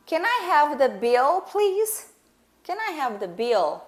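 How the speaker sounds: polite request with a clear L in "bill"; final L pronounced. Shown as a demonstration. Customer asking for the check, final L pronounced. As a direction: ask for the "bill", sounding its final L. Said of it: "esse L, ele tá lá".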